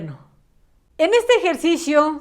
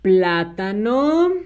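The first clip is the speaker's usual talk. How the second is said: very slow dictation, syllables stretched; dictating; 3 semitones lower, much slower than usual. Shown as a demonstration. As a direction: say it slowly as you write it out. Dicta mientras escribe. (dictando muy despacio, alargando las sílabas)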